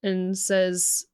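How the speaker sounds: normal talking voice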